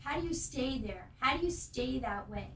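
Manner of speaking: ordinary delivery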